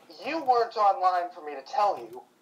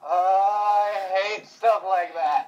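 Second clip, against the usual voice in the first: slow, drawn-out groan of dread; creeped out; 2 semitones higher, much slower than usual. Real, not acted. Listener after a scary story, creeped-out groan. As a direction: moan it slowly, creeped out.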